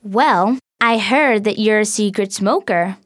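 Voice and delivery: mean smile. Acted. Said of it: "with a mean smile".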